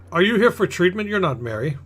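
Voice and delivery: a touch of aghast surprise at strangers; haughty newcomer. Acted. Aghast merchant to strangers at the mission door, lightly haughty.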